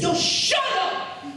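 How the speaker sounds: drawn-out, high-pitched mock shout; mock yell back. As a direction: imitate a defiant yell in a high voice. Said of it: "and I yelled back".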